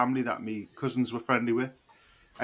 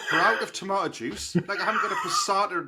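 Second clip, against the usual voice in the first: exasperated retort; 6 semitones higher than usual. Retold or imitated. Mocking the camper's tomato juice advice, exasperated laughing retort. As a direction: scoff at the useless advice, laughing. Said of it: "It's like".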